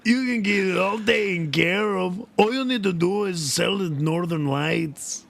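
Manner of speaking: hammy Irish stoner lilt; Irish accent. Retold or imitated. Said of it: "doing an Irish accent".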